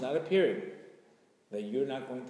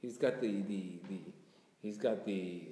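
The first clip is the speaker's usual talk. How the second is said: usual speaking voice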